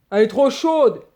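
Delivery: put-on old man crying out. A narrator's reading. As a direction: shout that it's too hot. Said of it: "crie le vieux".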